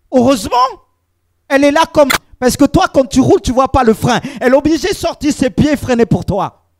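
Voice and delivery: loud, insistent sermon voice; fervent pulpit exhortation; preaching at full force. Acted. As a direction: press the point on the brothers. Preaching on submission.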